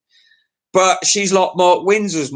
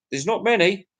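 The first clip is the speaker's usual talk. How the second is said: normal talking voice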